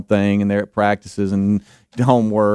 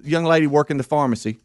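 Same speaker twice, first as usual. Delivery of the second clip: ordinary delivery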